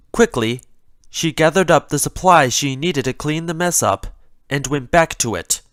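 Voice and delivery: ordinary delivery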